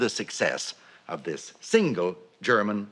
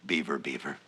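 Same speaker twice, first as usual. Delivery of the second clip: "Beaver, beaver" dropped lower and softer, like a reverent echo; hushed echo; 8 semitones lower, 8 dB quieter than usual. Acted. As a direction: let "Beaver, beaver" fall away to a low hush. Closing echo of the poem.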